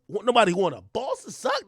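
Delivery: normal talking voice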